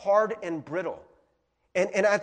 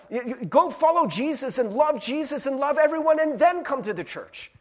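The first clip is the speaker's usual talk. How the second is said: high, loud run of demands; hammed-up preachy insistence; mock scolding church; 8 semitones higher, 7 dB louder than usual. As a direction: pile up the orders forcefully. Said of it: "that's the message that the church presents".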